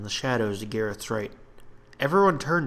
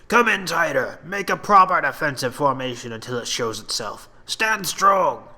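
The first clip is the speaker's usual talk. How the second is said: loud, higher barked orders; rally the men into formation; commanding captain; 4 semitones higher, 5 dB louder than usual. Acted. Captain ordering his men into formation, loud.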